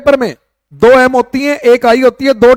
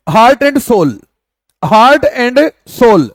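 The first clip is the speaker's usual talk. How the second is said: slow dictation; much slower than usual. Shown as a demonstration. Teacher spelling out an idiom.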